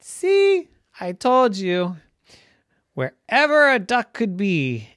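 loud, high proud boast; triumphant I-told-you-so. A narrator's reading. Ducky proves his parents wrong, declaring it triumphantly. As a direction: crow it proudly.

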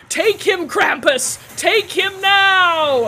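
loud, slow dragged-out command; commanding shout. Acted. Saja unleashing Krampus on Garrett, slow loud command. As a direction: order the beast to strike.

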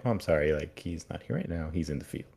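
soft, offhand receptionist brushing him off; sassy receptionist. Retold or imitated. Receptionist softly stonewalling Dan's urgent call, mildly sassy. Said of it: "the most sassiest, uh, receptionist".

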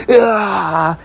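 sudden high yelp of being crushed; a quick mock scream; pained scream. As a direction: yelp as the giant's foot lands. Stomped on by a giant.

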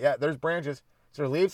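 usual speaking voice

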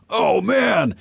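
high, loud amazed outburst; mock excited exclamation. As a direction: imitate a happily mind-blown player. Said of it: "He looked excited, not disappointed".

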